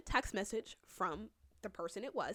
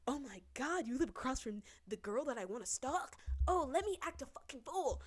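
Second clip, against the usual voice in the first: high-pitched, gasping mock excitement; exaggerated imitation of an overeager ex; gasp, then gush in a put-on high voice; mocking Johnny; 5 semitones higher than usual. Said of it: "Johnny was like".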